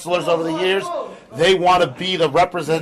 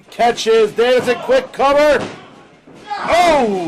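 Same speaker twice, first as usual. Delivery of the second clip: excited call; 6 semitones higher, slower than usual. Real, not acted. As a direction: push up to the count. Live near-fall call.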